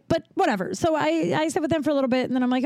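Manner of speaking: normal talking voice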